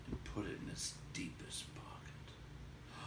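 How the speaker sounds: hushed secretive whisper; whispered furtively. A narrator's reading. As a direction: breathe it out like a secret. Secretive pocketing of the gem.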